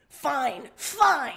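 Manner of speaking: shouted back. Acted. Karkat shouting back at his chanting friends, exasperated yell. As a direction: give in with a grumpy shout. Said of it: "You shout back".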